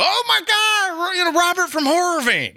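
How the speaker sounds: high, gushing fan squeal; starstruck fan. Retold or imitated. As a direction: gush like a star-struck fan. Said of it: "they go".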